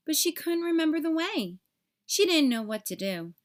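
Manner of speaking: plain reading voice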